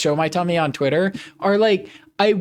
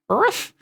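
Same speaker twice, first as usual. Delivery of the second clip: puppy bark; 12 semitones higher than usual. Pretend. Puppy streamer barking.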